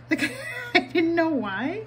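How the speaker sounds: laughing through the words. Real, not acted. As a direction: giggle as you say it. Laughs at odd moment.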